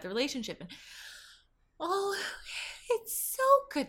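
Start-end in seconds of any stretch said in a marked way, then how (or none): 1.8-3.9 much higher, breathy-sweet gush; mock her sugary voice up high; mimicking her gushing talk. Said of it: "This is how she talks".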